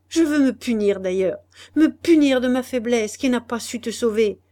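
put-on a young husband's high, loud, desperate plea; Guillaume in despair. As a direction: throw it at her, close to breaking. Guillaume's reply. (mari désespéré, voix haute et forte)

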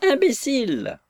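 squealing high-pitched screech through the window; yelping. Acted. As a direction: yap the insult like a furious little dwarf. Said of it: "Poufka glapir à travers la fenêtre".

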